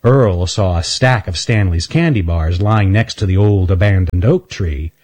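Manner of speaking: usual speaking voice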